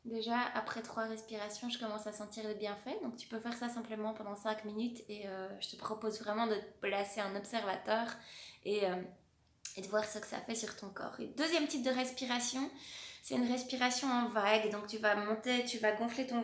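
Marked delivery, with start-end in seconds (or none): 0.0-11.2 soft, calm guided breathing voice. Acted. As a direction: talk them gently through the breath. Guides breathing exercise along.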